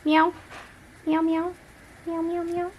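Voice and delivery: high, playful cat meows. Real, not acted. Meowing at the cat.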